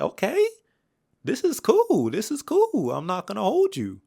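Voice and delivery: high, loud, hyped up; mock his own hyped reaction to the beat switch; excited reaction. Reviewer retelling his hype on first hearing the song, high and loud. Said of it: "So I was like".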